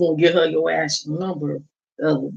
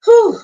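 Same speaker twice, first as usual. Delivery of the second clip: loud whew; 8 dB louder than usual. Real, not acted. Exhale exclamation.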